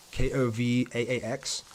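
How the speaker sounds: ordinary talk, nothing marked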